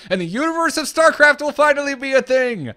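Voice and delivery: high, loud, laughing through the words; laughing excitedly. Real, not acted. Podcaster pitching a crossover joke, laughing excitedly. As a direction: burst into laughter as the joke lands.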